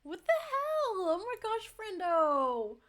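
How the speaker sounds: high, loud disbelieving outburst; a real shocked yelp of thanks; stunned surprise. Real, not acted. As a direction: blurt it out, truly floored. Surprise at a big donation.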